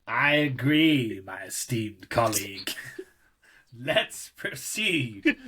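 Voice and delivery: slow, mock-formal posh English; stately put-on posh English; upper English accent. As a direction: draw it out like a pompous upper-class English gent. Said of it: "it's supposed to be upper English".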